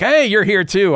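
a mock-cheery villain hailing old rivals; Gellert greets them. Villain hailing the party as he pops out, mock-cheery. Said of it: "who's like".

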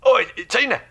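put-on London geezer accent, high and brisk; cockney fixer voice. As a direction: play a pushy cockney fixer on the phone. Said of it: "the accent was tuned to perfection right there".

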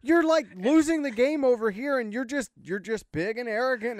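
high, loud exasperated rant at a smiling quarterback. Real, not acted. Fan ranting at Lamar Jackson's smiling after turnovers, high and loud exasperation.

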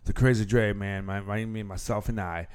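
booming show-host intro; grand entrance announcement. Acted.

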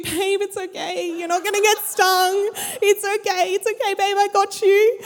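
high, mock-sweet soothing voice; cutesy comforting. Surfer comforting his girlfriend in the pool, cutesy soothing. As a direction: imitate a couple's babyish reassurance. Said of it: "they're having their little cute".

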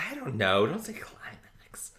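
squeamish, whispered protest; embarrassed whisper. Real, not acted. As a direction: whisper it, reluctant to use the word. Squeamish reluctance to say it.

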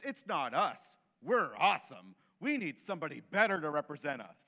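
loud, defiant crowd insisting they need a king; frustrated demand. Acted. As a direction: shout it like a crowd sure they're right.

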